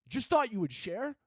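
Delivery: high, soft, disbelieving question; incredulous echo. Acted. Confronting the cat lady, incredulous. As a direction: repeat it back in hushed disbelief.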